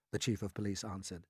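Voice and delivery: ordinary delivery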